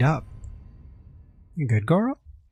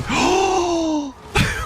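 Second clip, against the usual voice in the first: shocked gasp. Real, not acted. Ox cart knocks him off.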